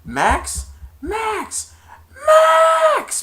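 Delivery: climbing from loud call to very high scream; building mock shriek; rising panic. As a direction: yell as the horse leaps. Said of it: "And he went".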